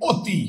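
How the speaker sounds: অতি said as "oti"; closed o. Shown as a demonstration. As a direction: start অতি with a rounded "o". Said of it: "স্বরে অ এর উচ্চারণ ও হয়ে গেল". (অতি-র উচ্চারণ "ওতি")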